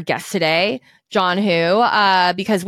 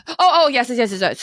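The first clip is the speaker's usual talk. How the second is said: re-enacted burst of excitement, starting high; gassed up; 4 semitones higher than usual. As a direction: act out getting all gassed up. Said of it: "I just got so gassed up".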